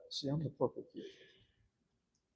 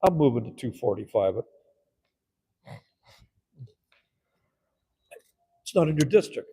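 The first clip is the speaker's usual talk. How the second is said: louder, pushing the motion through; forceful motion; 12 dB louder than usual. Retold or imitated. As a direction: councilman announcing his motion to the chamber, loud and forceful. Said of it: "I'd go".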